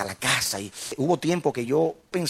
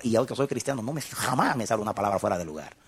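ordinary talk, nothing marked

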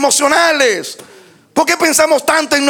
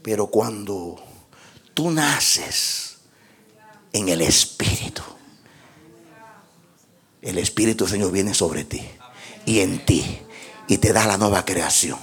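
much lower and softer, almost whispered; hushed preaching; 7 dB quieter than usual. Acted. Pastor telling the church of new birth, hushed and low. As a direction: draw the church in with a hush.

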